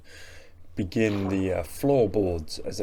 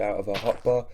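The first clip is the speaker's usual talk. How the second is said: ordinary delivery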